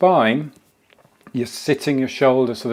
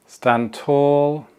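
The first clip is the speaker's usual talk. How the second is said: ordinary talk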